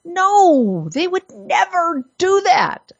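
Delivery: high, mock-indignant denial; exaggerated sarcastic assurance. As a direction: pretend it is utterly unthinkable, pitch jumping up. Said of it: "I must apologize for my sarcasm".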